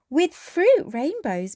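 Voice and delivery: put-on a light little-girl voice, slow; Peppa asking. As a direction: ask sweetly for fruit rainbows. Peppa the unicorn's voice.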